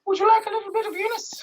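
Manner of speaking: very high, sing-song Irish leprechaun; squeaky, hammed-up Irish patter; Irish accent. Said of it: "And Irish is like".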